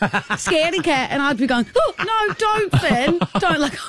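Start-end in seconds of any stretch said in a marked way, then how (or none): 0.0-1.1 high sing-song scaredy-cat taunt; mock child's teasing chant; taunting kid. 1.8-3.6 high pleading, mock frightened; hammed-up fear of flying toys; scared. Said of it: "I was honestly scared".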